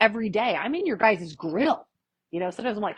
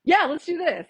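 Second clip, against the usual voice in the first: higher, louder eager yes; best friend Leslie agreeing eagerly, a touch higher and louder; 9 semitones higher, 4 dB louder than usual. Retold or imitated. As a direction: imitate the friend jumping at the idea. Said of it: "She goes".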